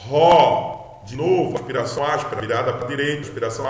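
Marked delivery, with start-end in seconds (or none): 0.1-1.0 RÓ loud, with a breathed H before the open o; English H. Shown as a demonstration. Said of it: "você lê com H do inglês".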